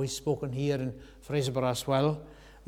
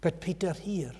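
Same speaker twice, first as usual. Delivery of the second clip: normal talking voice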